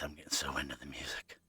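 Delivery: breathy whisper under the music. Real, not acted. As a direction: whisper it to yourself. Aside while rocking out to music.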